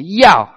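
Yao sharply dropping, loud like shouting; short falling shout on Yao. Shown as a demonstration. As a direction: bark Yao downward at someone. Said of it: "Everybody say".

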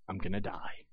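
hushed, low frightened murmur; low frightened dread; terrified. Acted. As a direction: whisper your doom to yourself. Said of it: "He's terrified".

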